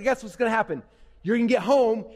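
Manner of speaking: normal talking voice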